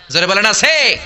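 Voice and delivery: loud call pushing the crowd to answer back. Real, not acted. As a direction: shout it out to the congregation. Preacher calls crowd to answer. (শ্রোতাদের উদ্দেশে জোরে হাঁক)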